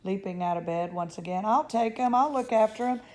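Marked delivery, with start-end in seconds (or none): 1.5-3.0 eager. A narrator's reading. Grandpa Joe eager to go.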